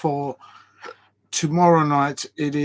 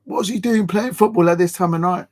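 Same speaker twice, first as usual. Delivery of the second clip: complaining neighbour; 4 semitones higher, 4 dB louder than usual. Pretend. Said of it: "I'm gonna get the neighbours".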